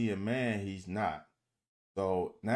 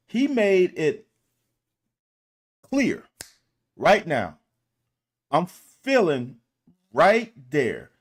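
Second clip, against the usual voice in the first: emphatic; 6 semitones higher, 9 dB louder than usual. Real, not acted. Emphatic coaching call on film.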